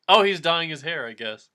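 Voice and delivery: ordinary talk, nothing marked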